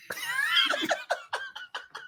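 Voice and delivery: a high burst of laughter at himself. Real, not acted. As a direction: laugh off the failed sentence. Laughing at failed tongue twister.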